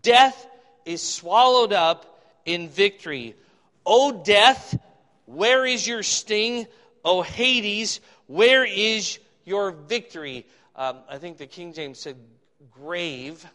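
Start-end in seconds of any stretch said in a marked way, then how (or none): 0.0-10.4 loud, slow, declaimed Bible verse; scripture reading. Acted. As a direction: proclaim it with ringing emphasis. Reads scripture aloud.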